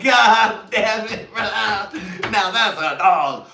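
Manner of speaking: very high, loud and slow; loud drawn-out exclaim. Retold or imitated. Reacting to his friend, loud, high and slow. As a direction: stretch it out in loud disbelief. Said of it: "I was like".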